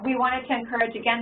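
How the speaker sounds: ordinary talk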